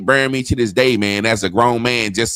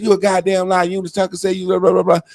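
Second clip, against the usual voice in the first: scolding mother; 9 semitones higher, faster than usual. Retold or imitated. Mother scolds lying son.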